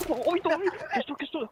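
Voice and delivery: excited. Real, not acted. Enemy spots teammate, panic.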